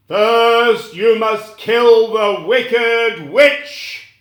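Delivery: loud, slow, booming command; demanding wizard. Acted. The wizard demanding the friends kill the witch, loud and slow. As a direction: lay down the terms with force. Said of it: "he demanded".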